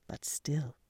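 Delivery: hushed aside in a whisper. A narrator's reading. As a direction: drop to a secret whisper. Narrator drops to a whisper.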